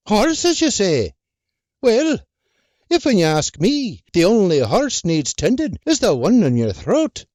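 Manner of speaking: high, teasing farm wife voice; sharp Irish wife. Acted. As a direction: needle him with sly wit. Wife character voice.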